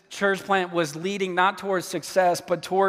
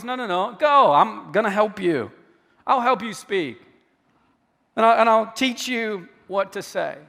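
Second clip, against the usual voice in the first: firm Go then gentle promise; a hint of a reassuring God. God answering Moses, firm then reassuring. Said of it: "He goes".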